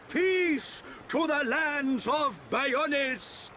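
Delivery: high, grandly intoned prophecy; prophetic proclamation. Acted. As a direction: proclaim it to the village. Chief proclaims a new prophecy.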